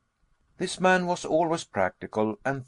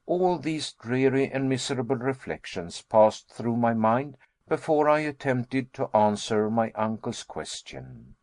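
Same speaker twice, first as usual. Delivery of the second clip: plain reading voice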